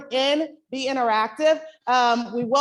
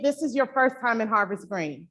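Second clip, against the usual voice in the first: ordinary talk, nothing marked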